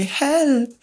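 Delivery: high, drawn-out little cry; mock call for help. Grandmother stuck in paint calls for help, high and slow. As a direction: call feebly for help, slowly. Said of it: "we could hear her calling".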